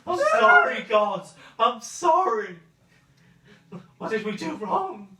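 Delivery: high, loud begging wails; desperate pleading cries. Acted. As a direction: cry out to God for mercy. GM voices burning cultists.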